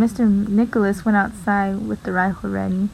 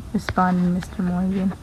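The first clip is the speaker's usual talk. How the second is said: plain reading voice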